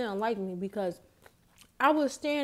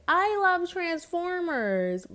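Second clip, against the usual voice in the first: slow, higher childlike imitation; imitating cousin; 8 semitones higher, slower than usual. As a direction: mimic the cousin slowly and higher. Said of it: "Like that".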